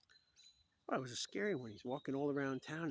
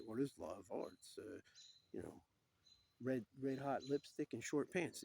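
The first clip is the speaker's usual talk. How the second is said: normal talking voice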